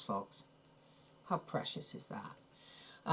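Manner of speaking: slow, hushed near-whisper; hushed cooing. Real, not acted. Showing viewers something precious, hushed and adoring. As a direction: say it very softly, almost whispered.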